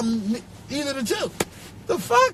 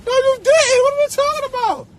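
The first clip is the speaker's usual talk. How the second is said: high, loud disbelief; loud, high-pitched scoff; incredulous outburst; 13 semitones higher, 5 dB louder than usual. Real, not acted. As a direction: burst out high in exasperation. Scoffing at fake posters.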